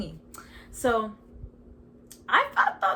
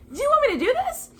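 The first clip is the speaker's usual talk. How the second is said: high, loud disbelieving ask; incredulous question; 8 dB louder, much faster than usual. Retold or imitated. Asking God if he really means it, high and loud. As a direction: pitch it up in disbelief.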